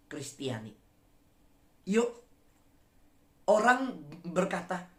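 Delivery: ordinary talk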